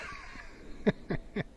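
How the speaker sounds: airy put-on giggle; breathy high cackle. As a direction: cackle softly in character. Laugh after the shout.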